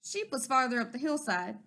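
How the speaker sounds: ordinary delivery, nothing marked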